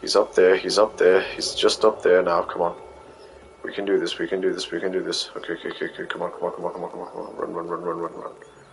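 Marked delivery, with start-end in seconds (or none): 2.4-8.6 very soft, repetitive muttering, fading to a whisper; anxious whispered pep talk; hushed self-urging. Real, not acted. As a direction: psych yourself up under your breath. Psyching self up while sneaking.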